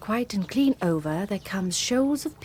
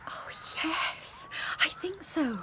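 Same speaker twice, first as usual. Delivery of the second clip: soft, high whisper; hushed wonder; 4 semitones higher, 7 dB quieter, slower than usual. Acted. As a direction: whisper so the bird stays put. Watching a heron, hushed.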